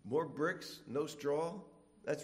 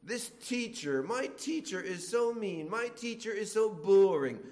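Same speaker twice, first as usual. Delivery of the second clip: higher whiny gripe, getting louder; whiny grumbling; 6 semitones higher, 4 dB louder than usual. Retold or imitated. Student grumbling about a teacher, higher and whiny.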